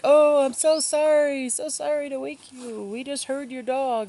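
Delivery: high, overdone sorry hiker voice; apologetic guy. Retold or imitated. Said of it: "And he's like".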